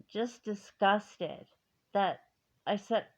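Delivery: ordinary delivery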